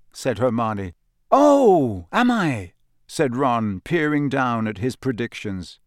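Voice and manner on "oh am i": mock surprise. Ron teased, mock surprise.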